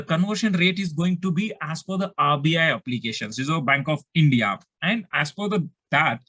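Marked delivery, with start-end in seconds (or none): none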